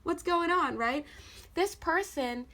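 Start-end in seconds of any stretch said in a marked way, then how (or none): 0.0-0.8 high, breezy mellow-dude greeting; laid-back Buddha. Retold or imitated. The card's Buddha figure greeting the viewer, high and breezy. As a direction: toss it off like a chilled-out buddy. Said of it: "Buddha, like, holding this bowl".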